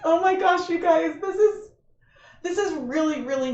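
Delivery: laughing through real tears; choked up. Real, not acted. Streamer thanking donors to the Trevor Project, choking up. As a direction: voice catching, pitch jumping high with a teary laugh. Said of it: "I'm sorry I'm getting emotional".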